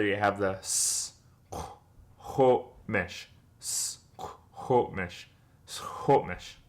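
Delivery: "s, ho, mish" split and fading; soft teacher demo; syllable by syllable. Shown as a demonstration. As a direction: breathe out "s, ho, mish" softly in parts. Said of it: "put it all together".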